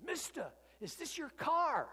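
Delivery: put-on high, bright-eyed kid asking the owner; little boy's voice. Little street kid asks Paul.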